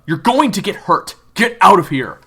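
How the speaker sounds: loud, high, irritated warning; angry warning. Acted. Kyler warning June to get out, loud and angry. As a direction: bark it at her to back off.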